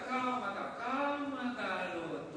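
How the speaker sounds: soft, falling, soothing voice; calma tranquilizadora. Acted. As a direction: ease the frightened boy down. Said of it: "Eliseu olhou e falou". (acalmando com voz mansa, cada vez mais baixa)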